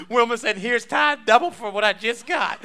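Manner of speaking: loud, high, bursting with laughter; talking through laughter. Real, not acted. Preacher reporting Wilma's double tithe, laughing loudly. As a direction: shout it out mid laughing fit.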